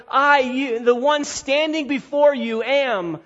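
"I" stressed high, pitch held up through "am"; emphatic I. Shown as a demonstration. Preacher voicing Jesus calling attention to himself, emphatic. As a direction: lift "I" and "standing before you" as a pointed claim. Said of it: "He's calling attention to the fact".

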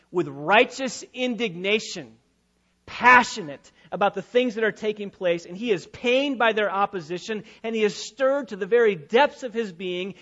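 driving, emphatic pulpit delivery; stirred, forceful sermon voice; impassioned preaching. Acted. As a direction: preach it with rising fervor.

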